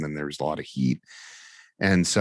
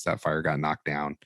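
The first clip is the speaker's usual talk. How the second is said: usual speaking voice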